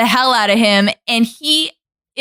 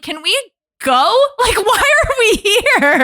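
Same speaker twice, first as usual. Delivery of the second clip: mock bored whine; 10 semitones higher than usual. Voicing bored Hook.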